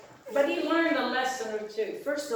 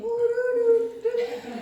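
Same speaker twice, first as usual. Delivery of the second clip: very high, loud alien hooting; squeaky alien doo-doo call; alien noise; 14 semitones higher, 5 dB louder than usual. Retold or imitated. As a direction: hoot like a cartoon alien. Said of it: "and with the alien thing".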